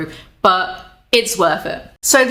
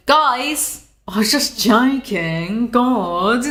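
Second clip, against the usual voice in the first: mock whiny excuse; 2 semitones higher, much slower than usual. Mocking the reporter backpedalling, slow whiny excuse. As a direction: whine it like a caught kid. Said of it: "He's retroactively decided".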